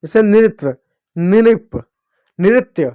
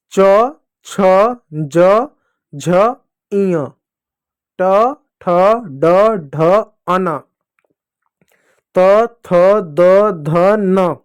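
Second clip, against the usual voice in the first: each letter separate, spaced out evenly; deliberate letter-by-letter chant for the class; recite-along drill; much slower than usual. Shown as a demonstration. Teacher has pupils repeat each letter group, recite-along drill. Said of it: "बोलिएगा मेरे साथ".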